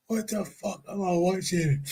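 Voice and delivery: ordinary delivery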